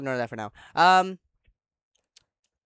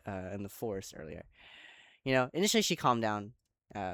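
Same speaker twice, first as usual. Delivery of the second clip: normal talking voice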